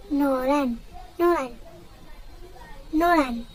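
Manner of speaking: "Nolan" repeated as NO-ran, Korean style; pronunciation demo. Shown as a demonstration. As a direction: model "Nolan" with falling endings and pauses. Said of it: "how to pronounce it in Korean".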